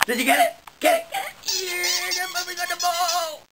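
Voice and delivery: excited puppy talk. Real, not acted.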